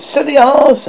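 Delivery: high, loud jeer; shouting. A narrator's reading. Tinkerbell mocks Tootles before darting into hiding, shouting. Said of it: "Tinkerbell shouted".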